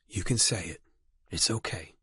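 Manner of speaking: soft, hushed reassurance; whispering. Acted. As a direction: say it gently under the breath. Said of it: "he whispered".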